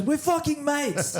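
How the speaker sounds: high, slow emphatic mimic; high slow emphatic mate voice; mock Jeremy. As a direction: declare it with mock fervour. Jeremy declaring friendship.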